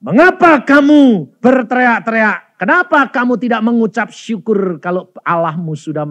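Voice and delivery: retold divine rebuke at full voice; thundering rebuke. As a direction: boom the question out like a scolding. Lord's rebuke, second forceful take.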